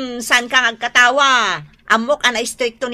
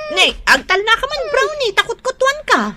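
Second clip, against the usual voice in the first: scolding the dog; 12 semitones higher than usual. Acted. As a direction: shoo the dog off sharply.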